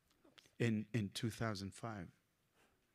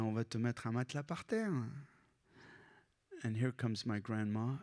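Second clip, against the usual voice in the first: ordinary talk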